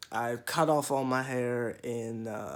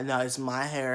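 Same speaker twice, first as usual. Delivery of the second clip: ordinary talk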